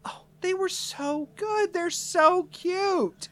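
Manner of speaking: put-on high, gushing girlfriend voice, drawn out; Maria. Maria hugs him after the show, high gushing voice. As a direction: coo it way up high, stretching the words. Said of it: "Maria comes up to you".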